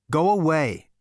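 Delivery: high, loud order to go; sharp dismissal. A narrator's reading. Zaphod shooing off the bird, high and loud. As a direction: send the bird off loudly.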